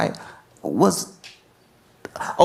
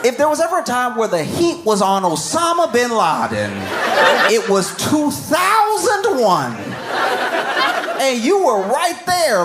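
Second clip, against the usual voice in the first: mock shout; 9 semitones higher than usual. Real, not acted. Comic mocking lawyer, rising shout.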